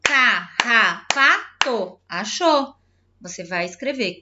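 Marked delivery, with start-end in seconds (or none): 0.0-2.0 "Carrapato" in four slow separate beats; "Carrapato" drawn out, a beat per syllable. Shown as a demonstration. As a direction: count out each syllable of "Carrapato". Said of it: "a palavra com quatro".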